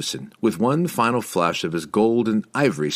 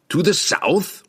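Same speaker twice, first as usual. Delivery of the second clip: higher, eager happy question; happy cry; 10 semitones higher, slower than usual. A narrator's reading. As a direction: cry out with glee. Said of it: "the dwarf cried happily".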